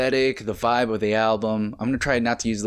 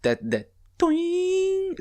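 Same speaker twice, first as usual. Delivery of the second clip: imitated twang; much slower than usual.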